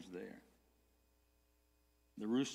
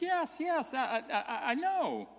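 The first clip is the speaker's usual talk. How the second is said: put-on high, excited accusing girl's voice; a servant girl blurting out her accusation; 11 semitones higher, 6 dB louder than usual. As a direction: point the finger, stammering with eagerness. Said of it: "another servant girl says".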